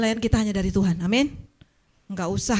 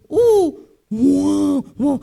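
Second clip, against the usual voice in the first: mock lion roar; 5 semitones higher, 7 dB louder, much slower than usual. Imitating roaring toothless lion.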